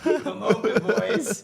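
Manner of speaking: high, laughing movie-trailer voice; mock dramatic line. Teasing the boys before the game, high hammed-up announcement. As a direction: ham up the big moment with a laugh.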